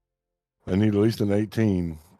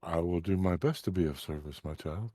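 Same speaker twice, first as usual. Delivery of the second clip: soft, lower kindly sage; gentle sage voice; 4 semitones lower, 8 dB quieter than usual. Acted. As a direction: speak softly like a wise elder. GM voices serene librarian.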